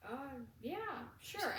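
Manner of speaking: hesitant, rising up to sure; uneasy, unsure yes; nervous. Retold or imitated. Nervous reply to boss.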